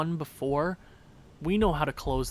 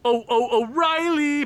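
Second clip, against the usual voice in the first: high, loud cheering of the name; excited yelling; 9 semitones higher, 5 dB louder than usual. Real, not acted. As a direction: cheer the name as the puck goes in. Celebrating goal.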